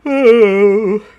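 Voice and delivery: high, loud laugh breaking the song; burst of laughter. Real, not acted. Breaks off singing.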